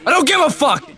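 yell. Real, not acted. Shouting over game voice chat.

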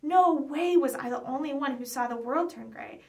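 ordinary delivery, nothing marked

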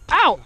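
pained yelp. Real, not acted. Being treated, wound poked.

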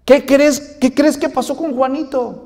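put-on high, eager child calling his dad; a small boy bursting with news for his papá; excited little boy. Niño emocionado llamando a su papá.

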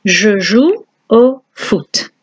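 Je joue au foot spoken slowly; slow French model. Shown as a demonstration. Teacher slowly modelling the French sentence. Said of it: "I play football".

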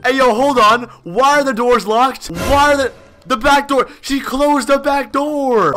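rising dread as you find the doors locked; alarmed. Real, not acted. Player trapped in the house discovering locked doors, alarmed.

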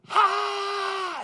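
shrill high scream of joy; loud scream. Retold or imitated. Stranger on the course screaming in shock, shrill scream. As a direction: scream out like a golfer gone wild. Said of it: "We heard this loud scream".

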